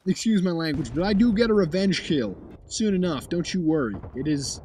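ordinary talk, nothing marked

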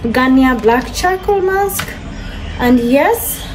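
normal talking voice

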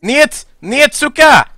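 high, loud furious Russian shout; yelling niet. Acted. Russian soldier yelling at the enemy player, furious. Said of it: "I just kept in yelling, "Niet, niet, suka".

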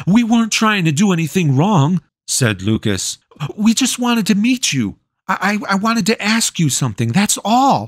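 put-on high, fast, nervous boy voice; a boy caught by the witch, talking fast in fear; frightened boy. A narrator's reading. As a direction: babble out an excuse in a panic. Lucas boy voice, pleading.

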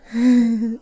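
tipsy giggle; drunken giggle. Acted.

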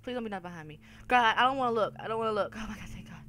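normal talking voice

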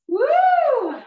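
high excited whoop; excited woo. Real, not acted. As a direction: cheer the class on with a whoop. Cheering after a set.

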